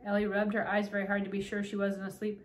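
ordinary delivery

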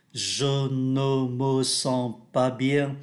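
ordinary talk, nothing marked

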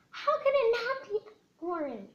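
high, loud outraged question; can't believe it's not orange; indignant disbelief. Real, not acted. Kid playing a guessing game, loud indignant disbelief. Shocked the answer was wrong.

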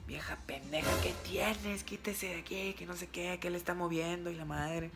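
lightly voiced mom scolding the teacher; imitating angry mom. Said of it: "lo voy a decir así como dijo".

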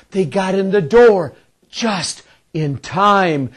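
ordinary delivery, nothing marked

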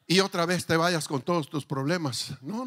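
usual speaking voice

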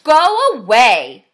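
loud, drawn-out grouchy ladybug; storybook grouch shouting. A narrator's reading. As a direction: shout it slowly to drive someone off. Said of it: "shouted the grouchy ladybug".

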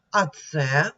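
usual speaking voice

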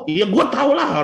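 emphatic comeback. Retold or imitated. Acts his emphatic comeback.

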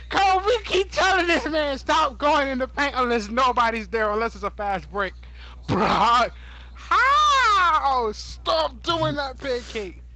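high, drawn-out pleading scold; exasperated scolding. Real, not acted. Scolding Pancake for going in the paint, high exasperated. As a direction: plead with the teammate to stop.

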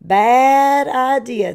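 loud, slow, stretched-out rooster voice; scolding rooster. A narrator's reading. Rooster tells off Turkey in disguise, loud and drawn out. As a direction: draw it out loudly, disapproving.

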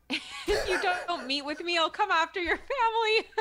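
high, loud, laughing through the words; laughing while reading. Real, not acted. Reading a joke submission aloud, laughing loudly. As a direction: cracks up mid-sentence.

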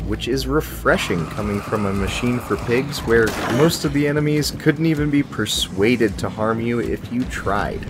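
usual speaking voice

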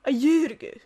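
YEAR AGO in a put-on Welsh accent, vowels rounded; loud mock-Welsh accent on "year ago". Shown as a demonstration. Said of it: "it turns into".

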